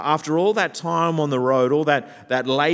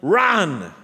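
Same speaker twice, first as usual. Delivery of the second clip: loud, raised rallying shout; imitated yell; 5 semitones higher, 7 dB louder than usual. Preacher voices Moses banging tablets together, yelling. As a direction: shout it to urge a runner on.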